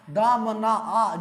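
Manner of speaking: दामना slowly, ending in an extra आ; खींचकर उच्चारण. Shown as a demonstration. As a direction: draw out दामना and echo its final आ. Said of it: "उच्चारण करने के बाद आ आवे". (दामना धीरे, अंत का आ अलग से)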